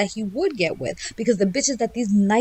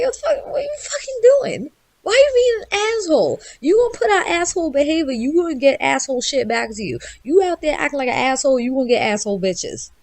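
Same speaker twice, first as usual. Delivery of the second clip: high-pitched mock telling-off, settling toward the end; scolding; 5 semitones higher than usual. Blunt advice-giver confronting someone who messed up, scolding. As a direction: chew them out in a raised pitch.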